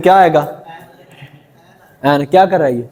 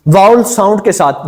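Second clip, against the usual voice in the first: ordinary talk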